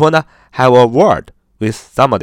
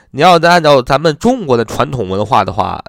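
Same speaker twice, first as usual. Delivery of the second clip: ordinary talk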